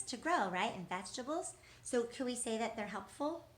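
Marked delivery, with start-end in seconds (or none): none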